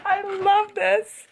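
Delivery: high, drawn-out gushing; delighted squeal. Real, not acted. Reader reacting to the one-room twist, delighted squeal. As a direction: squeal it slowly through giddy laughter.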